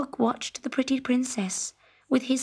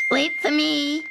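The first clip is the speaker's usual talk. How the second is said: calling frog; 5 semitones higher, 3 dB louder than usual. A narrator's reading. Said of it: "cried the frog".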